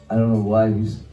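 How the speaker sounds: normal talking voice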